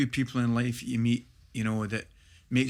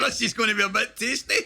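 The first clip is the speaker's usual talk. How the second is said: chuckling anticipation, higher; laughing; 9 semitones higher, 3 dB louder than usual. Retold or imitated. Player eyeing the big rival in the tunnel, laughing with relish. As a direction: laugh through it, relishing the fight.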